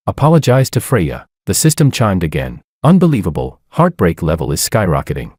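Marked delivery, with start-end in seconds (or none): none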